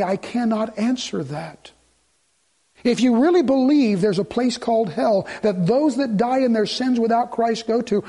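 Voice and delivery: ordinary talk, nothing marked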